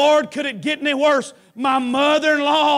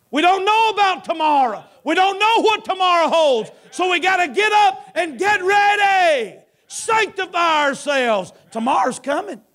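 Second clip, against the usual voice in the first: higher, driving revival preaching; preaching at full force; 6 semitones higher than usual. Acted. Preacher warning the congregation that tomorrow is coming, fervent. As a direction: build the sermon with urgency.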